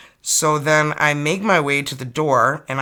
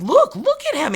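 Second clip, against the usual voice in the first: frantic urging; 4 dB louder than usual. Retold or imitated. Frightened, urging husband to look.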